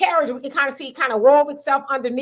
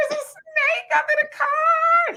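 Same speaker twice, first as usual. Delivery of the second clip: panicked yell. Retold or imitated. Shouting to the helper that a snake is under the car, panicked high cry. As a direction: shriek it like you're terrified of the snake.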